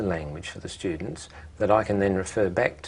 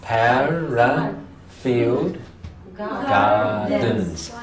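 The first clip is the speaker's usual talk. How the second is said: Parafield drawn out very slowly, then Gardens; teacher sounding out the suburb; slow syllable demo; much slower than usual. Shown as a demonstration. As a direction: stretch each syllable of Parafield. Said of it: "Let's have a look".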